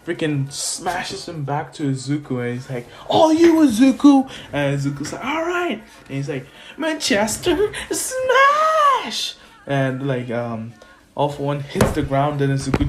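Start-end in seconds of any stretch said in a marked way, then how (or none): 3.1-4.3 high, loud hero yell; acted-out urgent call, pitch way up; battle handoff. Acted call to partner mid-fight. 5.2-5.8 high-pitched acted-out assent; mock young hero's readiness; eager reply. Izuku answers the battle call. 6.8-9.3 very high, stretched mock yell; hammed-up finishing move, drawn out long; attack cry. Acted superhero attack cry.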